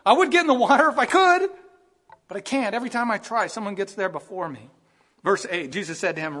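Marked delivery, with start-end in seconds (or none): 0.1-4.7 high, loud pleading, fading at the end; desperate sick man. Retold or imitated. Paralysed man answering Jesus, desperate pleading. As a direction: beg like a man who keeps missing his chance. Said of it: "The idea is".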